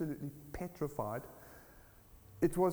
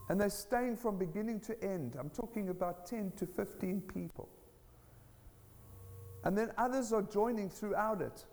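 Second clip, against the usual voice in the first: raised pitch, punchy phrase openings; fervent preaching; 7 semitones higher than usual. Real, not acted. Telling church of people coming to Christ, fervent preaching. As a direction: proclaim it to the whole church.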